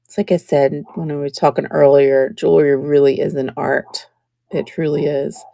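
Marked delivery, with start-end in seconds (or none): 4.5-5.4 soft sincere whisper; whispered aside. Real, not acted. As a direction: say it under your breath, meaning it.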